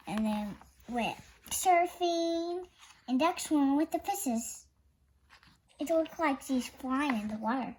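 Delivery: usual speaking voice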